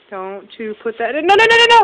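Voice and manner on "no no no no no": loud warning. Real, not acted. Mother stops baby mouthing something.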